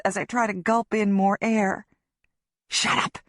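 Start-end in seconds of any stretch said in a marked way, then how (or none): 2.7-3.2 urgent hiss. A narrator's reading. Hushing her in panic.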